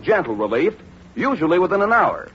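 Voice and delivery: ordinary delivery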